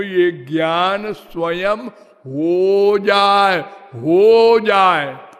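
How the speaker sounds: ordinary talk, nothing marked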